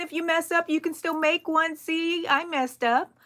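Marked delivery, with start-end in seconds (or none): none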